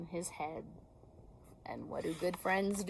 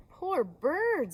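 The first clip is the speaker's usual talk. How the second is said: Poor Bird said higher and louder, falling; higher pitying Rabbit voice; 8 semitones higher, 5 dB louder than usual. Acted. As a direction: lift the voice, sorry for Bird.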